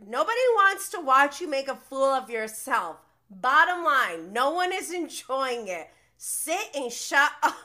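loud, emphatic mimicked telling-off; retold put-down. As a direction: act out her bossy takedown, laughing at the end. Acts out her bossy put-down.

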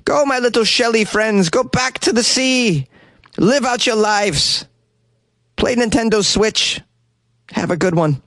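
imagined Joseph freeing his shelly friends, high and slow; like a fond parent waving goodbye; tender farewell. Retold or imitated. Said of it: "Be like".

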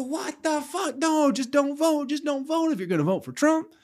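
high, shrill mock panic voice; shocked shriek. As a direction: squeal it like an appalled voter clutching pearls. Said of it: "And then they'll go".

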